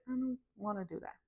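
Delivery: soft, grudging mumble; mock reluctant reply; reluctant refusal. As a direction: push back, unwilling and quiet.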